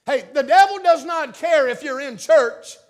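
ordinary talk

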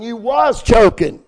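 loud, slow WAS; "You was choking" with WAS punched slow and loud; stress on WAS. Retold or imitated. Preacher correcting the soldier, WAS hit loud. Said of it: "You're not choking".

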